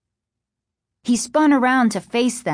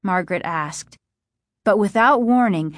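plain reading voice

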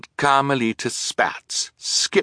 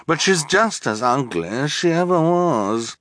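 high, affected insult; theatrical disdain; disdainful villainess; 7 semitones higher than usual. A narrator's reading. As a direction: insult her in a high, haughty voice.

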